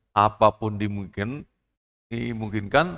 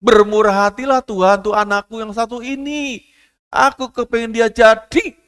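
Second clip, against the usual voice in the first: high, loud pleading prayer; mother's plea; 11 semitones higher, 6 dB louder than usual. Acted. Widowed mother Bu Larti pleading to God for her daughter, high and loud. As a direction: beg God for your child. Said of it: "doa yang dimohonkan untuk Bu Larti".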